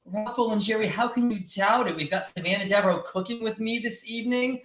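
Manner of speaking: normal talking voice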